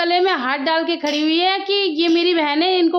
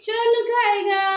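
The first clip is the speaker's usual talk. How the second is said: high, slow sing-song reply; 6 semitones higher, slower than usual. Acted. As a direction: sing it back playfully. Playful sing-song answer to child. (ऊँची धीमी गुनगुनाती आवाज़)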